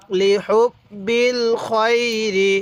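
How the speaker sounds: slow joined chant, খয়রি drawn out; measured syllables, crisp হুব and long খয়রি; model recitation. Shown as a demonstration. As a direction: stretch the glide in খয়রি. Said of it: "এরকমভাবে শিখব".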